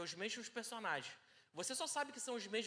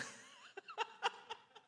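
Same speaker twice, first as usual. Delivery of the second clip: a real laugh breaking out high; sudden real laughter, pitched up; high burst of laughter. Real, not acted. Laughs at his own mimicry.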